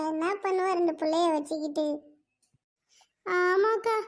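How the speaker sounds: ordinary talk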